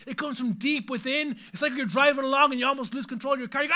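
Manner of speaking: normal talking voice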